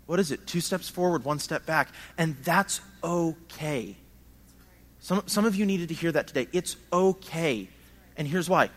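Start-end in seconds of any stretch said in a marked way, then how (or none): 2.2-4.0 reassuring. Real, not acted. Reassuring the congregation. 6.5-7.7 reassuring. Real, not acted. Reassuring the congregation.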